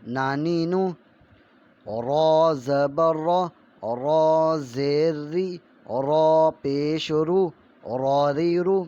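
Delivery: slow, loud sing-song recitation of letter sounds; syllable drill. Shown as a demonstration. Teacher drilling children in Urdu letter sounds, slow and loud. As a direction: chant each syllable slowly like a classroom primer.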